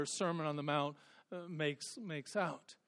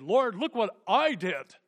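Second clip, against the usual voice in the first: louder, higher boasting mock; a smug man showing off his deeds; mock boastful ruler; 5 semitones higher, 8 dB louder than usual. Preacher mocking rich young ruler's boast, loud mock pride.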